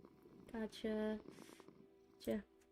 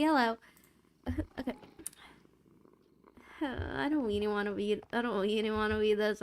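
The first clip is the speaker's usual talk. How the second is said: ordinary talk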